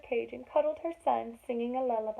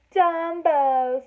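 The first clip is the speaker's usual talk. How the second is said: mocking name-calling; 6 semitones higher, 9 dB louder than usual. Elephant mocks the baby's name.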